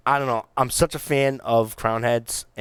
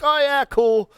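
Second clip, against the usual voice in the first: oblivious shrug; 14 semitones higher, 3 dB louder than usual. Retold or imitated. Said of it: "totally like".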